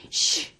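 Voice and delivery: hissed puff of air, "shh"; imitated angry huffing. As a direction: mimic air huffing out of the nostrils. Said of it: "콧구멍에서 바람만 씩씩 불었어". (씩씩대는 콧바람 흉내)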